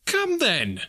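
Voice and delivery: high, playful comeback. A narrator's reading. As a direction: toss it back gaily, pitched high. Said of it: "returned the nephew gaily".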